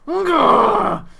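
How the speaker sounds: loud angry shout of the name. Acted. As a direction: bellow the name. Brute shouts own name.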